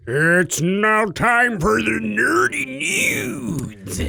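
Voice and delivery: slow, drawn-out announcer voice; segment announcer. Acted. Cohost introducing the podcast news segment, slow announcer voice. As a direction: announce the segment like a show jingle. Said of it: "how about we get into".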